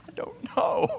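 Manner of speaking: whispered mock-sob; whimper. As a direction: whimper it like a sheepish husband.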